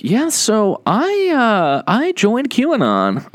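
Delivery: high, slow sheepish confession; future QAnon convert. Acted. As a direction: play a convert haltingly breaking the news. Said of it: "just be like".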